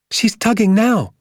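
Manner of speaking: put-on high, urgent child's voice; boy bursting with anticipation; excited boy. A narrator's reading. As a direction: report it to dad in an excited high cry. Excited boy reports to his father.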